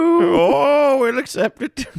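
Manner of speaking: high, loud put-on voice; hammed-up Irish; mock Irish barman. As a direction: grudgingly accept in stage Irish. Continues barman bit.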